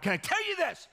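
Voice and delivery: high, loud, driving preacher voice; full-force preaching. Acted. Pastor rallying his congregation, high loud preaching. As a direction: lift the room, preach it hard.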